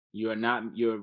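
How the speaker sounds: ordinary talk, nothing marked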